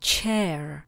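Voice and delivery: "chair" with a clear American r at the end, "cher"; American accent. Shown as a demonstration. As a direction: say "chair" the American way with the final r sounded. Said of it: "A jestli chcete s americkým přízvukem".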